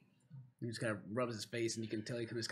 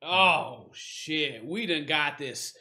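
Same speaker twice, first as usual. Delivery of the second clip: lightly voiced dwarf, drawn-out words; affronted dwarf; 4 semitones higher, 13 dB louder, much slower than usual. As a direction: slowly take offence as a tough dwarf. Dwarf reacts to the human's insult.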